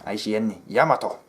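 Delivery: Yamato in a put-on Japanese accent; imitated Japanese accent. Shown as a demonstration. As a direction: imitate Japanese speech, short final 'toh'. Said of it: "not supposed to be racist".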